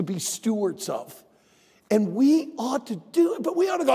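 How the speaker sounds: rally the congregation; preaching. Acted. Pastor building into preaching.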